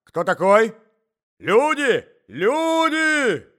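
very high, loud calls for help; shout, climbing to a drawn-out yell; alarmed shouting. A narrator's reading. Old husband finds intruder, calls servants, alarmed shouting. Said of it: "закричал старик".

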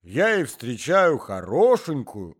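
put-on high, slow old man's chatter; a doddering old husband rambling on; old husband starting his tale, high and slow. A narrator's reading. Old man speaking.

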